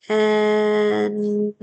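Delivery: "en" held long as one sound. Shown as a demonstration. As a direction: stretch out "en" steadily.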